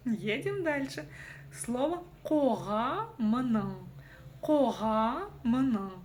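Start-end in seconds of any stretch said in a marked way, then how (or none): 2.2-5.9 «кога мына» медленно, по слогам; растяни каждый слог в «кога мына»; показ по слогам. Shown as a demonstration. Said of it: "каждую букву вы прочитать можете". («кога мына» учительским медленным показом, каждая буква слышна)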